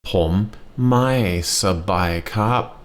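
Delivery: Pom mai sabai kap stretched out very slowly, syllable by syllable; slow the phrase right down for learners; very slow model. Shown as a demonstration. Teacher repeating the phrase very slowly for learners. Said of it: "again, please".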